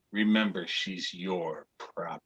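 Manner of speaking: low, hushed parting warning; quiet, low-voiced dealer; departing slave seller. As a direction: drop low and very soft to warn the buyer.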